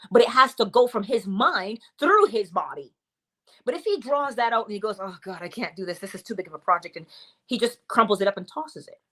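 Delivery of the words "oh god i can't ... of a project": discouraged. Acted. Architect giving up on his drawing.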